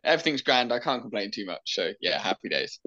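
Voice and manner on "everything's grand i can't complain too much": usual speaking voice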